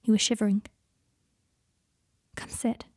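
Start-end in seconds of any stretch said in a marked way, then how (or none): 2.3-2.8 breathy whisper right at his ear. A narrator's reading. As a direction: coax him gently, voice barely there. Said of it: "he said into Draco's ear".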